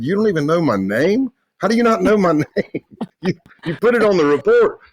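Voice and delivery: can't believe they forgot his name; incredulous. Retold or imitated. Agent mocking a friend who forgot his name, incredulous.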